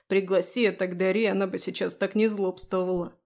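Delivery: plain reading voice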